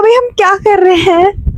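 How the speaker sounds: indignant disbelief. Retold or imitated. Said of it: "मैं कहा". (ऊँची आवाज़ में हैरान विरोध)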